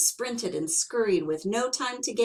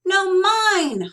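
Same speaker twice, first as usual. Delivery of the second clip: much higher and louder holler; 11 semitones higher, 10 dB louder than usual. Acted. Bruce hollering back at Sorrel over the cone. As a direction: yell him down. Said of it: "hollered Bruce".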